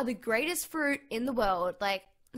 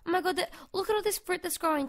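usual speaking voice

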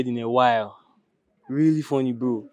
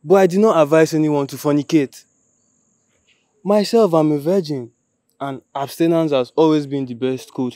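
loud, emphatic self-defence; firm, raised-voice rebuttal; defensive declaration; 7 dB louder than usual. Acted. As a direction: proclaim your innocence at full voice. Defends himself against friend's accusation.

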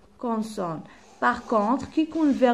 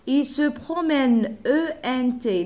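slow, raised, carefully spaced words and letters; slow dictation; 4 semitones higher, 3 dB louder, much slower than usual. Shown as a demonstration. Teacher spells the plural verb for pupils, slow emphatic dictation. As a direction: dictate it slowly, then spell out the ending.

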